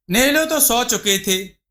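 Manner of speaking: ordinary delivery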